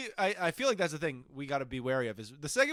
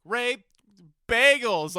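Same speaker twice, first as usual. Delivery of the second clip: high, much louder wrestler call; loud mock announcer; 9 semitones higher, 11 dB louder, much slower than usual. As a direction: shout it like a ring intro. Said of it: "It's like Rey Mysterio".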